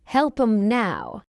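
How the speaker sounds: measured "Help 'em now"; slow model. Shown as a demonstration. Teacher models the phrase for learners first, slowly. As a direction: take time over "Help 'em now". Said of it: "fast English is not difficult".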